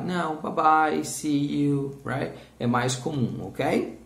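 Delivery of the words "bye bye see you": ordinary talk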